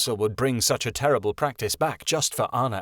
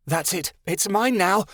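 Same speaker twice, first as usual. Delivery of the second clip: yelled; 7 semitones higher than usual. A narrator's reading. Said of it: "the strange voice yelled".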